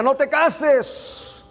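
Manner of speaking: high-pitched, drawn-out plea; emphatic warning. Retold or imitated. Acting out repeated warning against marrying.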